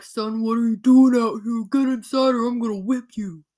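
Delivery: loud, stern threatening father; King Clarkson voice. Retold or imitated. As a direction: bellow like a cruel king. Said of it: "that's exactly what we imagine he sounds like".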